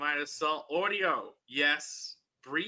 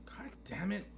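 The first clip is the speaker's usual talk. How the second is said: whispered curse under breath; whispering; 12 dB quieter than usual. Real, not acted. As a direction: hiss it to yourself. Mic failing, muttered curse.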